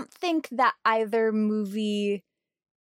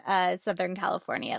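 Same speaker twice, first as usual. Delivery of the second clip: normal talking voice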